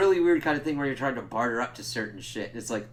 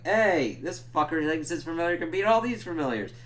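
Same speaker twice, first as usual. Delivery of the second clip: raised, higher voice; mock heckling call-out; 5 semitones higher, 3 dB louder than usual. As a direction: call out like a challenger. Voicing warlock heckling the fighters.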